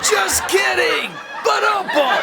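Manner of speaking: high, loud, laughing punchline; hammed-up comic sting, pitched very high; stand-up rimshot. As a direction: sell the gag with a rimshot. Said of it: "stand-up comedian".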